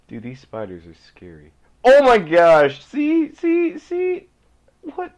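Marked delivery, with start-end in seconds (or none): 1.8-5.1 high, loud cry of alarm rising to a squeal; startled shriek. Real, not acted. Gamer attacked by spiders, startled high shriek. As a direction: shriek as if spiders just jumped out.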